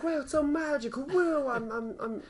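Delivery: hammed-up awestruck fool; dazed Lockhart. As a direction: gush in high amazement. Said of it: "He's like".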